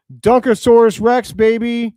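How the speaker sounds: loud and high, celebrating the dunk; hyped shout. Real, not acted. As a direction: yell it like you just saw a monster slam.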